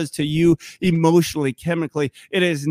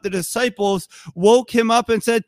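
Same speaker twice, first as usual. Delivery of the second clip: normal talking voice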